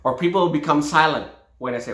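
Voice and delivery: usual speaking voice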